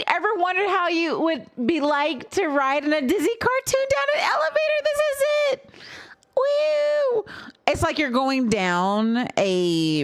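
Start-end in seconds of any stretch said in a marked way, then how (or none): 0.1-5.7 much higher, giddy reading; excited. Real, not acted. Reads chat question excitedly. 6.4-7.2 high, gleeful whoop; excited whoop. Real, not acted. Cheering at the elevator ride.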